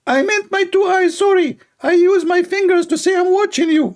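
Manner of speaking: high mocking preacher voice; squeaky sermon mimicking a preacher; Ali Dawa. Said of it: "Ali Dawa".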